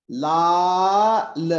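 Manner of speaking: लाल stretched very slowly; drawn-out syllables. Shown as a demonstration. Teacher stretching लाल slowly to count syllables. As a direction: say लाल slowly, drawing the vowel out. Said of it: "two syllables".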